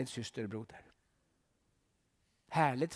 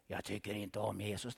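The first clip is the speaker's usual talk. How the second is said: soft devil reply; 6 semitones lower, 7 dB quieter than usual. Acted. Devil answers the preacher.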